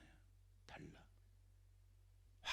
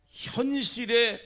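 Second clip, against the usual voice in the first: very slow, loud, much higher 현실에; drawn-out emphasis; 10 semitones higher, 12 dB louder, much slower than usual. Real, not acted. 회중에게 핵심어 현실에를 길게, 높고 크게. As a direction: stretch 현실에 out high and loud. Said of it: "preacher stresses key word".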